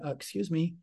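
mock-timid, hesitant interruption; acting shy, barely daring to speak up. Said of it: "we're not gonna be timid".

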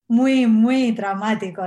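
slow, loud stress on traumático; slow emphatic insistence. Real, not acted. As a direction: lean hard into muy, muy traumático. Reliving a traumatic birth.

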